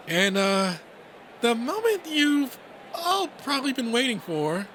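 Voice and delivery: high, hesitant rabbit emcee; timid announcer. Acted. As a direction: build to the big moment nervously. Timid rabbit announcing main event.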